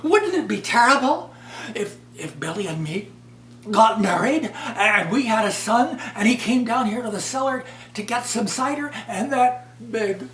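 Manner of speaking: put-on high, wailing worried girl, cracking into laughter at the end; a high, near-tearful voice that breaks into giggles; fretful silly daughter. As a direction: play the silly girl working herself into a fright. Silly daughter fears imagined accident.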